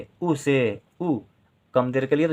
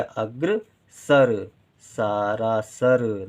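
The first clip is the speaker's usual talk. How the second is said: slow sounding out; much slower than usual. Shown as a demonstration. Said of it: "teacher sounds out the word".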